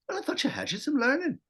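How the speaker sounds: put-on high-pitched Irish accent, lifting at the end; Irish accent with rising sentence ending; Irish lilt. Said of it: "it goes up at the end".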